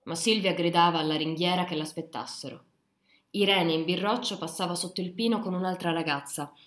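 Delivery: ordinary delivery, nothing marked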